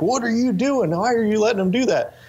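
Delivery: high-pitched mock yell, retelling dad.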